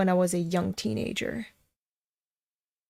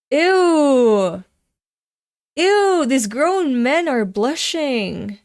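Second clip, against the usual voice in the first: loud, much higher, slow disgust; disgusted shriek; 5 semitones higher, 7 dB louder, much slower than usual. Real, not acted. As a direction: shriek "ew" in revulsion. Disgusted at blushing men.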